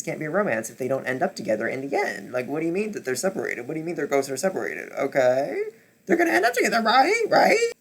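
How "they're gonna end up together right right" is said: high, loud, near-crying plea; laughing and crying at once; desperate hopeful cry. Real, not acted. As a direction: beg the story to turn out right. Desperate hope over book ending.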